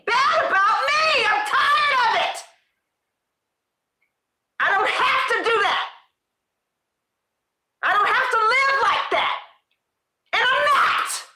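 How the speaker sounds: high, loud and fed up, half laughing; fed-up shout. Real, not acted. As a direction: shout it out, done with beating yourself up. Fed up with self-criticism.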